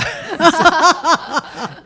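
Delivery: sudden very high laugh; high squealing laugh. Real, not acted. Laughs at glue-sniffing joke. (尖声大笑)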